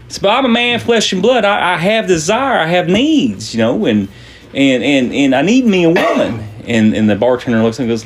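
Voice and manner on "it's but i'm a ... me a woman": high, hammed-up protest of need; desperate pleading. Miner begging the bartender for help, hammed-up desperation. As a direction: plead your case with pitch pushed up.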